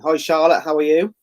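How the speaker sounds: usual speaking voice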